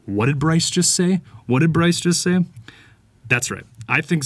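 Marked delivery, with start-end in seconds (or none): 0.1-2.5 startled listeners. Acted. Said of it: "they're like".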